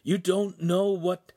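high, slow cautioning voice; warning. A narrator's reading. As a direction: try urgently to stop the master. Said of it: "Pan warned".

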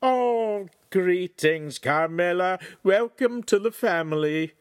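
high, loud delighted greeting; beaming welcome. A narrator's reading. Salesman welcomes the new house girl, beaming. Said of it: "Sancho beamed at this bit of news".

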